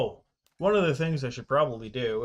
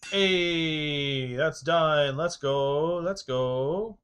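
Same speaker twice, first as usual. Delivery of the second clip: higher, louder happy cheer; excited celebration; 4 semitones higher, 6 dB louder, much slower than usual. Real, not acted. Streamer celebrating that the job is done, excited. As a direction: cheer yourself on with a drawn-out "go". Celebrates finishing a task in game.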